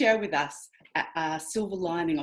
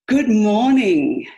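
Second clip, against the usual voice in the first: loud, slow, drawn-out good morning; big greeting; 2 semitones higher, 10 dB louder, much slower than usual. Real, not acted. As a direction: open the meeting with a big welcome. Host opening meeting greeting.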